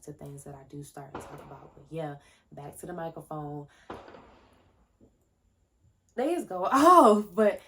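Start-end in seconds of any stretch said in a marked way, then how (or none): 6.2-7.6 bursts out laughing mid-word, much louder and higher; real laugh breaking through the words; cracking up loudly. Real, not acted. Laughing at her mic trouble.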